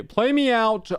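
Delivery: calling out. Acted. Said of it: "calls for outro music".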